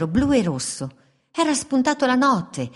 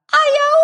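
high, loud cartoon yelp; a sudden squeal as the kick lands; yelp of pain; 8 dB louder than usual. A narrator's reading. Wolf kicked by the fox, high loud yelp.

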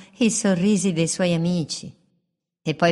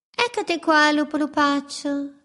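higher, sly bird voice; mangy birds, mocking; 9 semitones higher than usual. Mangy birds greet the wolf, higher mocking voice. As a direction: tease the wolf from the branch.

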